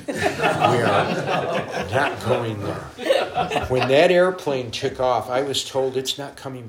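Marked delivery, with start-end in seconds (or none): 0.1-3.7 drawn out very slowly through laughter; laughing refusal. Real, not acted. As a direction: laugh as you dig in your heels.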